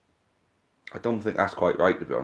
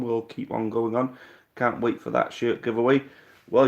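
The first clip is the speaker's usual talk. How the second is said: ordinary talk, nothing marked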